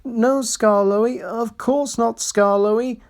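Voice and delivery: high, slow meek coaches; meek quavering. A narrator's reading. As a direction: quaver it like scolded carriages. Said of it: "quavered the coaches meekly".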